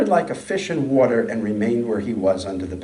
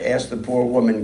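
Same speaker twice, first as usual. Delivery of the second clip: ordinary delivery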